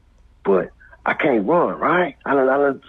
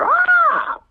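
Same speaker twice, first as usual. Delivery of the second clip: much higher, louder scream; mock crowd scream; 4 dB louder than usual. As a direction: yelp like a startled crowd. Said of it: "everybody's like".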